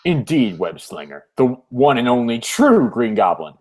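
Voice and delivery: low, grand villain voice; deep mock-sinister proclamation; Green Goblin villain. Voices villain's reveal in comic.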